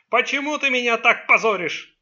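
loud, high, angry reproach; enraged. A narrator's reading. Father reproaches son for shaming him, enraged. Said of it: "разгневанно спросил Чанг".

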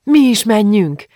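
high, loud, drawn-out eager call; eager suggestion. A narrator's reading. Gitta wants to join the street celebration, eager call. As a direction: propose it with real excitement. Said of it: "indítványozta Gitta felcsillanó szemmel".

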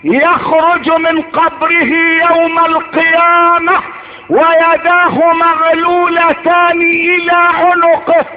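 ordinary talk, nothing marked

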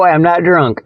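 ordinary delivery

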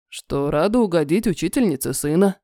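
low man's voice; steady, low, matter-of-fact; major's voice. A narrator's reading. Майор отвечает. (низкий мужской голос)

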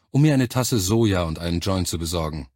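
plain reading voice

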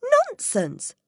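high, loud firm refusal; firm retort. A narrator's reading. Alice refuses the Queen, firm and loud. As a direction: plant your feet and refuse. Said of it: "Alice said firmly".

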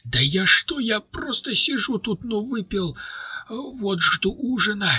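a big man squeaking out excuses; high pleading. A narrator's reading. Ryzhy excusing himself to the guards, high timid squeak.

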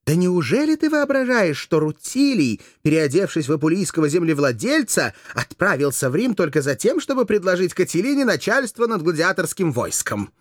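high, emphatic rhetorical question; vehement, animated argument; incredulous challenge. A narrator's reading. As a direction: scoff at his naivety with heat. Courtesan argues incredulously.

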